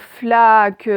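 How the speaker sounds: "flaque" loud and stretched, "fla" held before "que"; syllable demo. Shown as a demonstration. Teacher showing pupils the first syllable, loud stretched demo. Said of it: "première syllabe".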